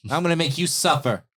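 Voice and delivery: high, loud prisoner's threat; threatening yell. Acted. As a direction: yell vengeful threats at a jailer. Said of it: "he yells, he's like".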